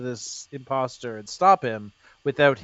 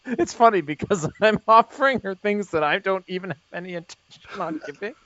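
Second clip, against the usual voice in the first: laughing; 3 semitones higher, 4 dB louder than usual. Real, not acted. Player laughing at own scheme.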